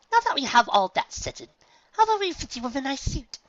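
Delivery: high, loud chirpy saleslady; eager fashion-mare; Rarity voice. Acted. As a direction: brightly push the sale.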